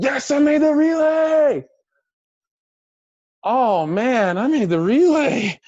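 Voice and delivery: high, loud cry of joy; elated shout. Retold or imitated. Swimmer celebrating making the relay, shouting with joy. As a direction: yell it out, overjoyed.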